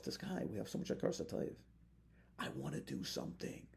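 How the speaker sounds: whispered. Shown as a demonstration. Grateful person's inner voice.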